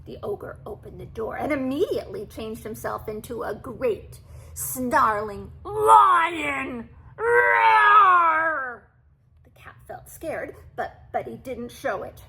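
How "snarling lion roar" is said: very slow, loud roaring lion. A narrator's reading. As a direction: roar like a huge lion. Ogre turns into lion.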